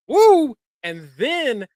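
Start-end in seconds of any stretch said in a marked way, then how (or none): 0.1-0.6 high, loud victory woo; excited whoop. Retold or imitated. Fan recalling the match, whooping as Solo nearly wins. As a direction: cheer like your pick is winning. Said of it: "And I was like".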